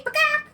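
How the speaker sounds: high squawked bakak; her bakak call. Retold or imitated. Wife retelling her answer to husband's call. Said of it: "and I say".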